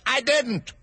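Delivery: high quick denial; March Hare interrupting the hatter in a hurry; hurried. Acted. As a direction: cut in fast. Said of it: "interrupted in a great hurry".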